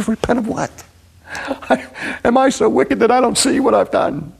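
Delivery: high, pained cry of a man searching his conscience; Job pleading. Acted. Job asking God what he did wrong, high and pained. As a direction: plead like a bewildered sufferer. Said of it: "He said".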